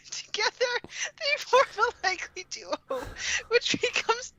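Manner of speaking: high, strained reading fighting back laughter; laughing through reading. Real, not acted. Reading a dinosaur romance blurb to friends, fighting laughter. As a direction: read it while barely holding in laughter.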